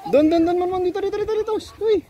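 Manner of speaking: high calling out mid-run. Real, not acted. Calling the kid while running. (malakas na pagtawag)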